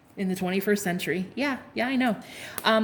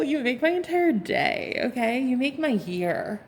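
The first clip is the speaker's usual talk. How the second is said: ordinary talk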